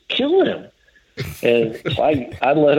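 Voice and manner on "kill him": sudden urgent command. Retold or imitated. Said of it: "And he just goes".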